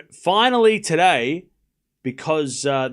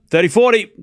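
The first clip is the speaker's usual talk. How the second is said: loud, higher excited score call; 8 semitones higher, 9 dB louder than usual. Real, not acted. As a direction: call the break point with a thrill. Calling key break point.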